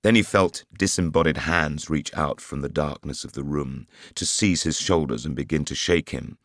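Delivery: plain reading voice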